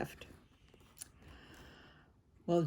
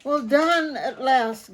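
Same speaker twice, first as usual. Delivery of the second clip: slow, louder breathless relief; Dad gasping; 7 semitones higher, 11 dB louder than usual. A narrator's reading. Dad done shoveling, breathless and slow. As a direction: puff it out worn out. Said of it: "gasped Dad".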